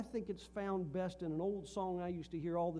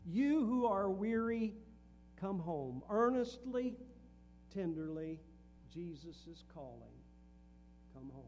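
reciting a hymn chorus; 3 dB louder than usual. Acted. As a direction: plead the chorus, then let it fade away. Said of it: "the chorus says".

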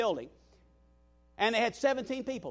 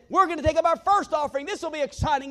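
higher and louder, pumped announcement; excited pastor; 8 semitones higher, 7 dB louder than usual. Retold or imitated. New pastor calls the first offering to the congregation, excited. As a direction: announce it like a thrilled host. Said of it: "He was so excited".

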